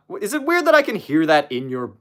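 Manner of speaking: normal talking voice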